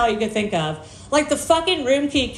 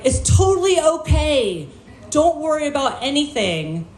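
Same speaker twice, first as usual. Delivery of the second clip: higher, emphatic voice of an apologetic clerk; overdone reassurance, pitched up; reassuring clerk; 5 semitones higher than usual. Retold or imitated. Clerk to supposedly deaf customer, emphatically reassuring.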